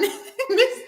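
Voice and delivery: short high burst of laughter. Real, not acted. Laughing at her own reaction, a high short laugh.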